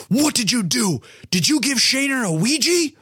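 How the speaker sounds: high-pitched, outraged interrogation; mock angry teacher. Gym teacher looking that kid in the face, outraged. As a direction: play a teacher confronting a culprit, hammed up.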